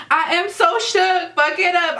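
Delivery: high, stretched-out amazement; shook, excited. Real, not acted. Fan cheering on the performer, shook and excited.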